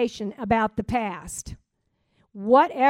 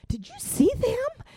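much higher, sudden squeak of surprise; startled disbelief; 13 semitones higher than usual. Retold or imitated. A driver asking in shock after cutting someone off. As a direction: pop the question up high in shock. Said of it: "and I'm like".

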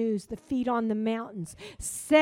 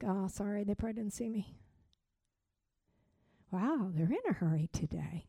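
gentle understanding; 3 semitones lower, 8 dB quieter than usual. Retold or imitated. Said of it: "And I'm like".

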